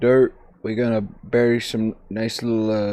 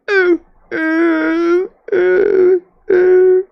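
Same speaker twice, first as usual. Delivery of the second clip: mock whimper; 4 dB louder, much slower than usual. Whimpering again.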